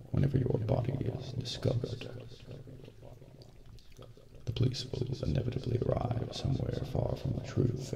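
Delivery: ordinary delivery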